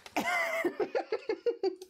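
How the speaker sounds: loud, high burst of real laughter. Real, not acted. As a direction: crack up loudly at the joke. Husband laughs at joke.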